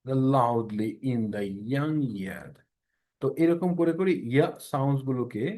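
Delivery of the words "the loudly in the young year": very slow, deliberate drill reading; model sentence. Shown as a demonstration. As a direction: stretch every word for the students. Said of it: "ইয়া কথাগুলোকে আগে আনবেন".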